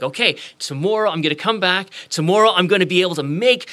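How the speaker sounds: high, gushing anticipation; all excited. Acted. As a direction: act out a boy bubbling over with big plans. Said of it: "and he's all excited".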